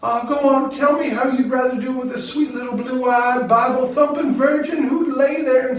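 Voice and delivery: a woman begging him not to stop; high urgent lover's voice; Marley's voice. A narrator's reading. Marley teasing her lover.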